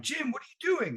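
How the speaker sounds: high-pitched soft line reading; audition line. Retold or imitated. Acted audition line retold.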